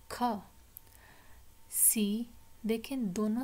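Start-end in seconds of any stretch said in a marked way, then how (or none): 0.1-0.3 short aspirated "ख" on its own; phonics sound. Shown as a demonstration. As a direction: sound of C as an isolated "ख". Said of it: "the next sound is". ("ख" अकेली छोटी ध्वनि)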